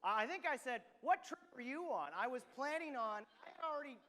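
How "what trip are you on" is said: loud, higher, fed-up snap; retold loud frustration; exasperated retort. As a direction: fire it back in disbelief. Exasperated retort to his wife.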